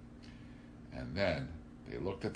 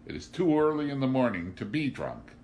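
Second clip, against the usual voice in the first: high, loud moral shock; raised, disapproving exclamation; scandalized; 8 semitones higher, 7 dB louder than usual. A narrator's reading. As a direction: protest as if deeply scandalized. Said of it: "in a scandalized voice".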